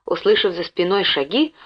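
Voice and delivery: plain reading voice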